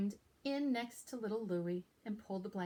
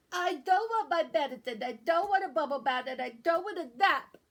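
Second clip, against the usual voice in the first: loud stuffy-nosed complaint; a sick kid refusing everything; whiny loud protest; 5 semitones higher, 8 dB louder than usual. Acted. Sick child protesting loudly.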